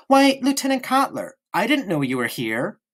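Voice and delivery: high, fake-surprised girlish mimic; a boy mocking his sister's pretence; hammed-up innocent surprise. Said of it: "she jumped and said".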